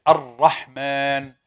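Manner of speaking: Ar-Rahman with a long, held Arabic ra; demonstrating the shadda on Ar-Rahman. Shown as a demonstration. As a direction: stretch the ra in Ar-Rahman slowly. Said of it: "I say it longer because it has shadda".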